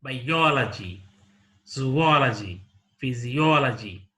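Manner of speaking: "biology", "zoology", "physiology" with OL stressed, ending on a fall; antepenultimate stress. Shown as a demonstration. Teacher repeating examples, "biology", "zoology", "physiology" stressed on OL. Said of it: "on the antepenultimate syllable".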